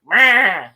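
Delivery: loud Wookiee bleat; imitated Wookiee roar. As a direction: blurt out a mock Wookiee cry. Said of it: "Kalnaka's like".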